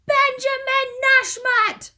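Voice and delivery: very high, loud, slow holler; hollered name. A narrator's reading. A boy hollering his full name over the band, slow and loud. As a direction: stretch out the name at full volume. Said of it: "hollered Benjamin Nushmut".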